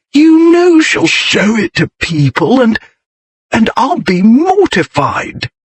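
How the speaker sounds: high, slow, desperate pleading; pleading desperation. A narrator's reading. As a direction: beg her not to shame him. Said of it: "Bertie's tones took on a pleading desperation".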